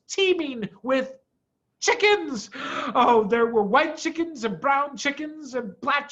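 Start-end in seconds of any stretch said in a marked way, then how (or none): none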